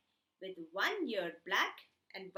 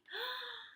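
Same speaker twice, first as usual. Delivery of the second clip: gasp; 12 semitones higher, 5 dB quieter than usual. Acted. Story gasp at open gate.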